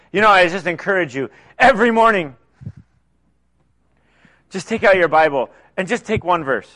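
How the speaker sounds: normal talking voice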